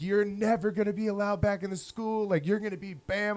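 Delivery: lightly acted indignant teacher; mock outraged.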